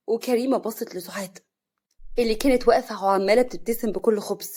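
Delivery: ordinary delivery, nothing marked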